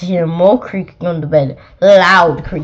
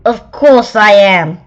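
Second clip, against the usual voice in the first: loud, slow, offended snap; snapped; 2 semitones higher, 9 dB louder than usual. Acted. Girl monster snapping at the picky child. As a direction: bite back, insulted. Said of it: "she snapped".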